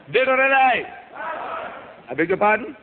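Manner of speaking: normal talking voice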